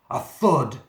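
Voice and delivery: loud, heavy thud; booming thud. Acted. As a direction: slam the word like a shoulder on the door. Monster pounding the door.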